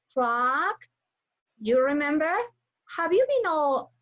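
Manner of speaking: normal talking voice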